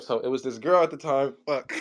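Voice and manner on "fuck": usual speaking voice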